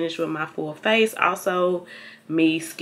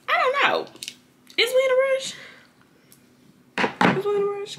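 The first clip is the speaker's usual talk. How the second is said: squeaky high, teasing question; high playful voice; faster than usual. Pretend. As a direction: pipe it up high and silly. Playful high voice asking around.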